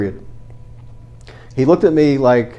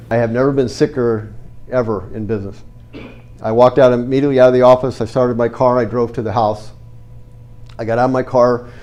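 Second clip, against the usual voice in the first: ordinary talk, nothing marked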